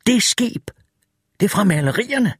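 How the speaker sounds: lightly voiced startled Wireman; shocked realisation. A narrator's reading. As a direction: gasp it out in disbelief. Wireman seeing the ship.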